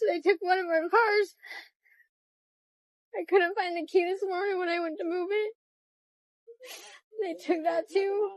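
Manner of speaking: fighting tears while telling it; real crying through the words. Real, not acted. Evicted mother breaking down.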